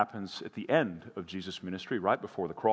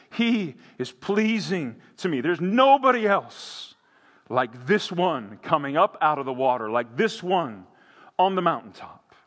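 higher, louder, swelling proud declaration; proud heavenly father; 7 semitones higher, 7 dB louder than usual. Retold or imitated. Pastor voicing God's pride in his son, rising and loud. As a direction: proclaim it like a delighted king. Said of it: "The high king of the universe saying".

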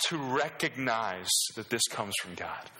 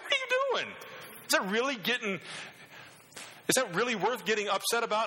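high, exasperated retelling of his wife; incredulous wife; 5 semitones higher, faster than usual. Retold or imitated. Wife catching him hitting the cupboard, high and incredulous. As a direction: mimic the wife's sharp high disbelief. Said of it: "My wife's like".

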